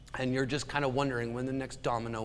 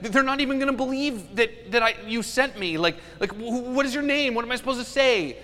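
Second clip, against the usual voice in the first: panicky Moses; 9 semitones higher, 4 dB louder than usual. Acted. Moses panics before God.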